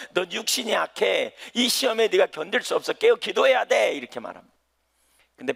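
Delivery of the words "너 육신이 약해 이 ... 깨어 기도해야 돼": urgent plea. Acted. Preacher voicing Jesus urging Peter to pray, building. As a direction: press the warning home, pleading.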